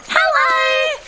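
put-on very high, loud little-kid greeting; squeaky young platypus voice; chiming children. A narrator's reading. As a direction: chime it out in a singsong. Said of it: "Petra and Pedro chimed".

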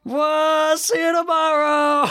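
high, drawn-out mock call; cartoon impression. Performs on co-host's request, high comic impression. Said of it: "Do the impression".

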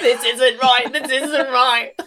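high, slow mock-panic squeal; high squeaky teasing panic. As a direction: echo her panic back, high and drawn out. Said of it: "there's, like".

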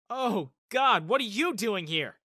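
loud call over a noisy club; startled shout across the dance floor; yelling over music. Acted. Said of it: "I yelled over the music".

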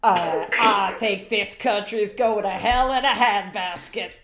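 a Texan politician grumbling, played slow; Texan accent. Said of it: "I need to ground myself in the accent".

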